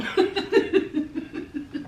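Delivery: long, breathy run of chuckles; amused laughter. Real, not acted. Host after saying they may be done, amused laughter. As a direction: laugh it out at your own quip.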